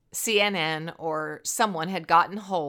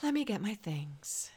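sighing softly; 8 dB quieter than usual. Acted. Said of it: "Hannah sighed".

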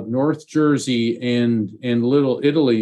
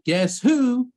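a bit higher, teasing cartoon call; playful cartoon tease; 11 semitones higher than usual. A medium relaying the father's game to the client, playfully higher teasing call. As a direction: tease it like a peekaboo. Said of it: "I'm hearing Woody Woodpecker".

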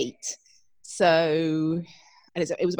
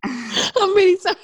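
laughing; 13 semitones higher, 6 dB louder than usual. Real, not acted. Laughing apology after a blunder.

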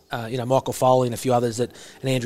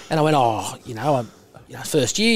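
ordinary delivery

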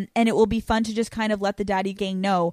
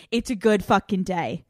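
usual speaking voice

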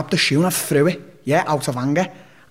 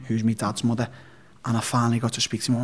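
usual speaking voice

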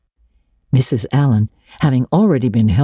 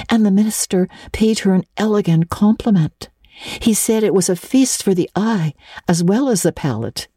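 Anne; 6 semitones higher than usual. A narrator's reading. Anne voiced in story.

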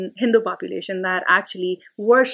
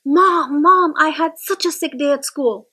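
eager son blurting out news, higher and louder; bursting with excitement about school; excited kid; 6 semitones higher, 4 dB louder than usual. Retold or imitated. Son excitedly tells mom.

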